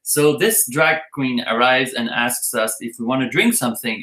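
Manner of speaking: ordinary talk, nothing marked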